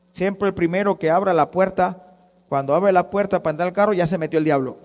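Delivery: normal talking voice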